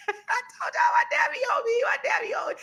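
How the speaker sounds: high squealing laugh through the words; cracking up about dad. Real, not acted.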